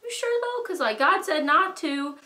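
high, hesitant squeak of a question; doubtful Eve. Retold or imitated. Eve questions the serpent about the fruit, high and doubtful. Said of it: "And she was like".

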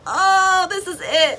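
light up with recognition; excited aha moment; realization. Retold or imitated. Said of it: "I was like".

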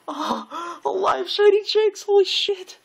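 thrilled all over again; real excitement. Real, not acted. Streamer seeing the live shiny Jynx, delighted yelp.

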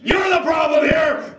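high, drawn-out shouted accusation; slow angry shout. Acted. Sibling repeating the blame, slow angry shout. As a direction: shout each word of blame slowly.

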